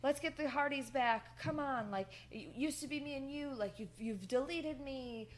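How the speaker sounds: hammed-up rallying appeal; imitating a pleading speech. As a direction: plead like a wrestler begging his old teammate back. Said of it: "Jeff tries to give a speech".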